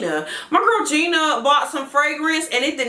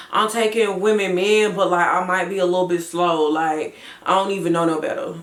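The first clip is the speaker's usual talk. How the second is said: raspy chill Chelsea; 6 semitones lower than usual. Retold or imitated. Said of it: "you think raspy, you think chill".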